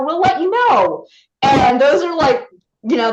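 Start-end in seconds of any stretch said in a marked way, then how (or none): 0.0-1.1 light, upbeat dismissal; breezy brush-off. Retold or imitated. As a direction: toss it off casually. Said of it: "the knife in the heart to any actor".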